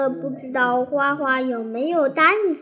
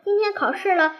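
mildly puzzled, higher-pitched question; lightly curious parent's query; 6 semitones higher, faster than usual. A narrator's reading. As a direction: ask it with a touch of wonder. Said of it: "有些纳闷地说".

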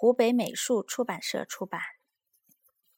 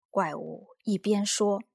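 usual speaking voice

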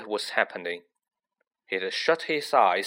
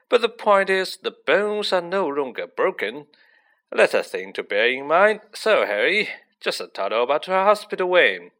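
loud, high, breezy cover-up after a blunder; flustered Lockhart; 4 semitones higher, 6 dB louder than usual. A narrator's reading. Lockhart to Harry after the botched spell, loud flustered breeziness. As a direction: brush it off like a vain showman, loud.

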